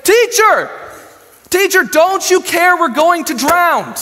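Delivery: high, loud, drawn-out panicked yell; screaming accusation. Acted. Terrified disciples waking Jesus in a storm, screaming accusingly. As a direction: scream it angrily at a sleeper to wake him. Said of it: "they're screaming at him".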